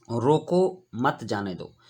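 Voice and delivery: रोको set apart by a pause, then मत जाने दो together, slowly; pause after रोको. Shown as a demonstration. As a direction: break right after रोको, keep मत with जाने दो. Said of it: "यहाँ रोको के बाद अल्प विराम कॉमा लगा हुआ है". (रोको के बाद रुककर, मत जाने दो साथ में)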